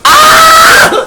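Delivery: high playful scream at the lighter; mock scream. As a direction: yelp in mock fright.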